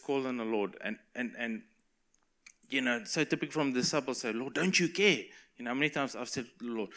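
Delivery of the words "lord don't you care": higher-pitched reproachful cry; acted-out disciple's complaint; pleading complaint. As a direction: plead with the Lord, a little exasperated.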